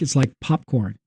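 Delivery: ordinary talk, nothing marked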